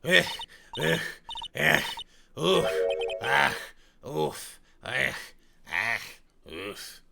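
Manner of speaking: put-on huffing and puffing old smoker; hammed-up puffing, out of breath; wheezing smoker character. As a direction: grunt between greetings as if winded.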